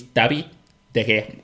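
"David" said dabi, the V turned to B; Spanish "David De Gea" again. Shown as a demonstration. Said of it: "The V is a B in Spanish".

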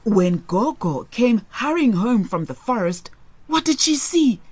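ordinary delivery

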